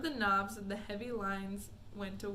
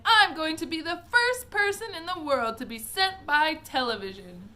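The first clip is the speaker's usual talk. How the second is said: loud, higher boast; shouting; 6 semitones higher, 10 dB louder than usual. Acted. Mike boasts loudly while running. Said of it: "he shouted".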